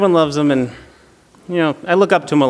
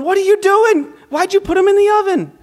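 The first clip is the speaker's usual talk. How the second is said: panicked mom; 13 semitones higher than usual. Retold or imitated. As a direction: squeal it like a horrified mom. Mom runs out, voiced in story.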